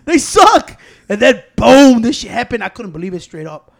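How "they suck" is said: much higher, louder, fast trash talk; mocking trash talk. Retelling a morning joke to a friend, high, loud and fast. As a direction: rattle off the joke like a heckler. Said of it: "And like".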